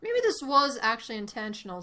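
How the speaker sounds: ordinary talk, nothing marked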